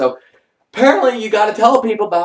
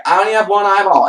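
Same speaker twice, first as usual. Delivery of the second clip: louder, pressing the point; insistent; 6 semitones higher, 7 dB louder than usual. Retold or imitated. She tells the paramedic, raising her voice insistently. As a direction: raise your voice to insist.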